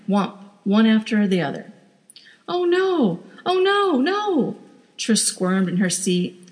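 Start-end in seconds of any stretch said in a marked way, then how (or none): 2.5-4.6 high, horrified wail. Acted. Wife watching rabbits get hit, horrified wail. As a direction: recoil at each thud.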